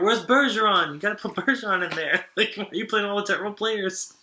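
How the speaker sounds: heckling fan. Retold or imitated. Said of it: "he goes".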